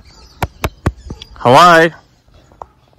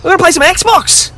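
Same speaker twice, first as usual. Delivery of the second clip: Jesus, cheerful. Retold or imitated. Jesus inviting Russell down from the tree, high cheerful. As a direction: beckon him down like an old friend.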